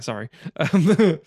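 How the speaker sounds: ordinary talk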